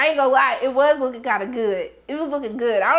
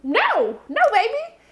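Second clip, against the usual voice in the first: mock outraged refusal; 9 semitones higher than usual. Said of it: "and they was like".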